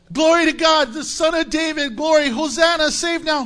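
high, loud festive shouts; crowd shouting praise. Retold or imitated. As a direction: cry it out like the cheering crowd. Preacher acts the shouting crowd.

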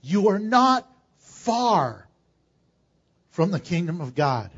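slow, deliberate reading, each word spaced out; read slowly. Shown as a demonstration. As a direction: let every word of the verse land slowly. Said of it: "Let me read it slowly".

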